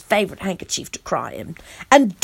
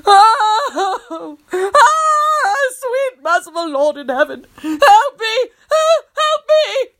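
high, hammed-up wailing and crying; 11 semitones higher, 4 dB louder, much slower than usual. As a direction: wail for help in panic. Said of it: "Tony wailed".

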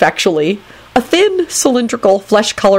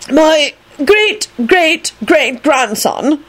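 high, very drawn-out announcement; importunate announcement; 9 semitones higher, much slower than usual. A narrator's reading. Phineas speaking up for his descendant, importunate. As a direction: announce it slowly and insistently. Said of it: "Phineas announced importunately".

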